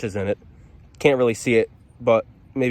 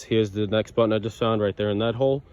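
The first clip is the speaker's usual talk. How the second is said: usual speaking voice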